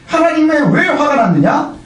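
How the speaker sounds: ordinary talk, nothing marked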